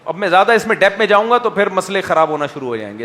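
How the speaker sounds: usual speaking voice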